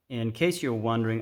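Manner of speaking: ordinary delivery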